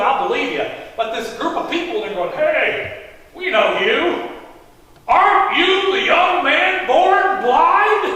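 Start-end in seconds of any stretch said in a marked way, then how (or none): 2.3-8.0 loud, high call, drawn out at the end; astonished neighbors. Acted. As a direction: call out in slow, high amazement.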